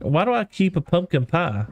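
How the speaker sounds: normal talking voice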